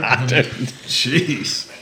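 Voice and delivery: usual speaking voice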